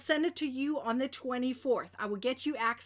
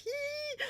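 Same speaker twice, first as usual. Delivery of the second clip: small, high squeal of glee; excited squeal; 11 semitones higher, 8 dB quieter than usual. Real, not acted. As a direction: let out a soft high squeal. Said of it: "I am so excited".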